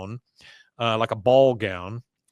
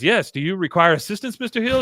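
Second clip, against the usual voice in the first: higher-pitched formal offer, lightly acted; Leoma; 7 semitones higher, 3 dB louder than usual. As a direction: imitate a helper, rising high at the end. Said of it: "She goes".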